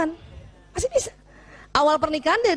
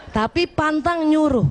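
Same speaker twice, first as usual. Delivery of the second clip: ordinary talk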